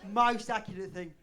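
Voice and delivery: loud imitation of a stunned listener; hammed-up, forceful verdict; mock astonishment. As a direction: blurt it out as if scandalized. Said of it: "You just put your hand over your mouth like".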